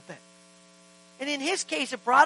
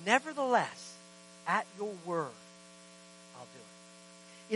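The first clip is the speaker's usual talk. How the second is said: quiet surrender; 5 semitones lower, 8 dB quieter, slower than usual. Retold or imitated. Peter yielding to Jesus, quiet and slow. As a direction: drop softly and slow down on "I'll do it".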